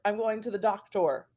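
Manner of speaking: "doctor" pronounced DOK-tor at the end of the sentence; spelling pronunciation of "doctor", full OR. Shown as a demonstration. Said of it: "we don't hear many people say".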